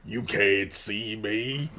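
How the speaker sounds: low, slow drawn-out taunt; weird voice. Acted. Wrestler taunts dazed grandma in a low, slow weird voice. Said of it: "in a weird voice".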